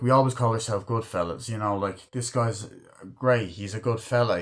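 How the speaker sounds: ordinary delivery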